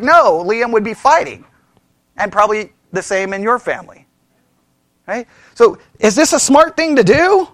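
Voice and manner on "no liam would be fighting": ordinary talk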